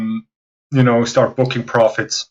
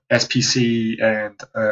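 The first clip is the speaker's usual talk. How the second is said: usual speaking voice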